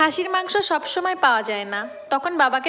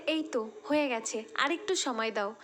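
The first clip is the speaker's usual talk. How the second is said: much softer, worn-out murmur; tired and quiet; 2 semitones lower, 8 dB quieter than usual. Retold or imitated. Mother wiping sweat, answering father in a soft, tired voice. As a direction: answer softly, too tired to raise the voice.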